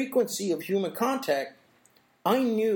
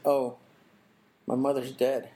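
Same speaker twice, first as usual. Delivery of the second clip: lower, mock-wounded and humble; played wounded; 6 semitones lower than usual. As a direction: play the hurt card to shame him. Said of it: "I played wounded".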